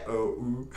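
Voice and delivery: o, u said as German umlauts; German umlaut sounds. Shown as a demonstration. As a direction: pronounce o, u as rounded German umlaut vowels. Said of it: "like, the umlaute in, in German".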